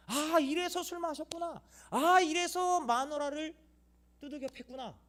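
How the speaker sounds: high, slow, drawn-out bitter realization; hurt son becoming his father. Retold or imitated. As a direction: drag it out like a broken father. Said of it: "이렇게 되는 거예요".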